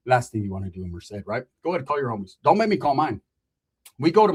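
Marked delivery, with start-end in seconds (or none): none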